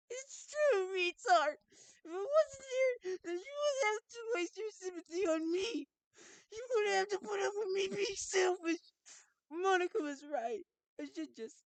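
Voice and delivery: put-on very high, loud, tearful girl voice; distraught self-blaming girl. Sayori blames herself for being selfish, wailing girl voice. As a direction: cry it out high, pleading and hurt.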